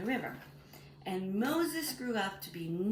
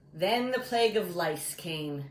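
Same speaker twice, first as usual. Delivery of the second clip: normal talking voice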